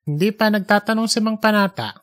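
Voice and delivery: usual speaking voice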